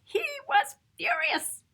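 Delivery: high, ringing furious; furious emphasis. A narrator's reading. Narrator to children, lifting furious up high. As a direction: lift he was furious up high.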